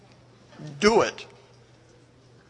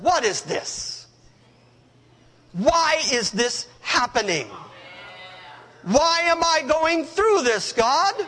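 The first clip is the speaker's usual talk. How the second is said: higher, slow, bewildered questions; pleading complaint; 7 semitones higher, slower than usual. Retold or imitated. A suffering believer asking God why, higher and pleading. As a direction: cry up to heaven in confusion. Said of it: "looked up at heaven and said".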